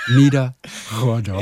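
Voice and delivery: slow, deliberate Niederrohrdorf; careful repetition. Shown as a demonstration. Host repeating the guest's village name slowly. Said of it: "Rohr wie Pipeline".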